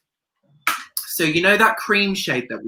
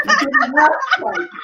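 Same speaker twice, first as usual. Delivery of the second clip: much higher, giddy squeal; squealing hype. Real, not acted. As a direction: squeal it like a fangirl. Joking hype repeated.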